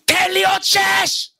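high, drawn-out gym shout; imitated trainer's shout; coach yelling. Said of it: "שבו אתה צועק עליו".

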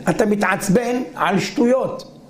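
normal talking voice

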